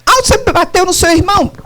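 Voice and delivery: high, quick mock outrage; scolding parent. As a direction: confront the child in an acted scolding. Acts out parent scolding a child.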